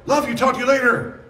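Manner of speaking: high, bright put-on goodbye; hammed-up high cheery sign-off; breezy friend sign-off. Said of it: "And then".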